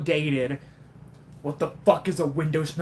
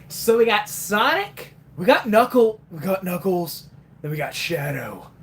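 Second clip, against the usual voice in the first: normal talking voice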